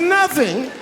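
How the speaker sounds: hammed-up astonishment; mock shock. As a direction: squeak it in feigned disbelief. His feigned shock at wife's news.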